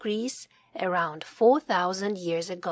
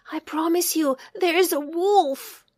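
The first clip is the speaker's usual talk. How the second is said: pleading; 8 semitones higher, 3 dB louder than usual. A narrator's reading. Sam begging disbelieving villagers.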